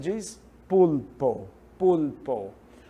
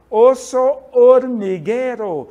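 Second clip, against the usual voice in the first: slow loud demo; 5 semitones higher, 9 dB louder, slower than usual. Shown as a demonstration. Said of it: "a palavra formiga no espanhol".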